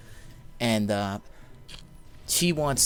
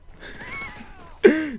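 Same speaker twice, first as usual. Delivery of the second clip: much higher, sudden laugh at the story's end; high burst of laughter. Real, not acted. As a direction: laugh out at his own tale.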